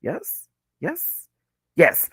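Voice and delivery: yeses sliding steeply upward; playful, questioning upswing on each yes; rising yeses. Pretend. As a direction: let each yes swoop up high. Comic run of repeated yeses.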